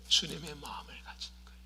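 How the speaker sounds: hushed whisper on 주님의 마음을 가진 거예요; hushed answer. Acted. Pastor answering his own question, whispered. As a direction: drop to a whisper on 주님의, then let the rest trail off. Repeated answer, second take.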